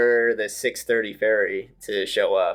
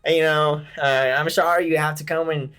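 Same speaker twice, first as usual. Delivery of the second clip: imitating a Southern accent; 6 semitones higher than usual. Drunk friend asks a firefighter for a hospital ride, hammed-up Southern accent. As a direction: play the drunk buddy going full Southern on the firefighter. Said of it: "oh gosh, I gotta try to do this".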